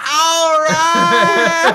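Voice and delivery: very high, loud triumphant shout; gleeful yell. Acted. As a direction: cheer it out triumphantly. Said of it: "He's like".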